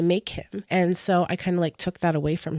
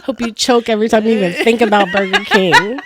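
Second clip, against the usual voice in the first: laughing mock curse; 4 semitones higher, 7 dB louder than usual. Real, not acted.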